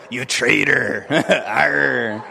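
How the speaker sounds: hammed-up pirate accuser. Shown as a demonstration. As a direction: growl it like a pirate. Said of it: "Say it like a pirate".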